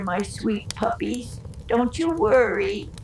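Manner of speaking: usual speaking voice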